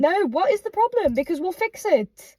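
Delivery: high-pitched, insistent demand; frustrated and emphatic; exasperated shouting. Real, not acted. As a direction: press for an answer, voice rising sharply. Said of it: "Just all the shouting".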